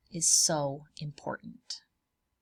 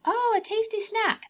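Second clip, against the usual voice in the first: much higher, bright pretend bird voice; hungry bird; 3 dB louder than usual. Telling children what a hungry bird thinks, in a high pretend bird voice. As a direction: play the bird spotting a snack. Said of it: "if a bird was like".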